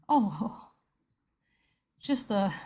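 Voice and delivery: ordinary talk, nothing marked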